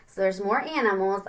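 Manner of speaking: ordinary delivery, nothing marked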